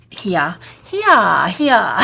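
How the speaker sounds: r-dropping Boston "here, here, here"; Boston accent. Real, not acted. Crafter slipping into her Boston accent mid-explanation. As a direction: let the Boston accent slip out, "here" as "heah". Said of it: "my Boston accent just completely came out".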